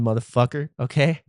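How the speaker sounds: ordinary talk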